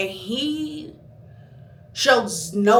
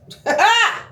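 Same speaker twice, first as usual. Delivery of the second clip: laugh; 12 semitones higher, 6 dB louder than usual. Real, not acted. Laughs at own anger.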